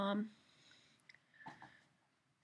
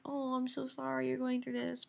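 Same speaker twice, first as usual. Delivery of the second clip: mock sympathy; 4 dB quieter than usual. Someone consoling an injured puppy, mock-pitying coo. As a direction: imitate someone fussing over a hurt puppy.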